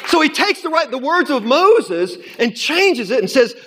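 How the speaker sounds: loud, high, driving sermon delivery; fiery preaching. Acted. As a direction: preach it full force to the saints. Preaching at full force.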